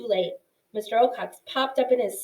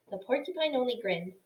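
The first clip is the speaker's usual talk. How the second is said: plain reading voice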